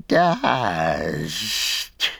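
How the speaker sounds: lightly hissed creature voice; hissed Gollum word. A narrator's reading. Gollum clawing the ashes, a drawn-out hiss. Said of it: "he hissed".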